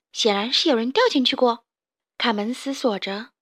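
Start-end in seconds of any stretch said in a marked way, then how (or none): none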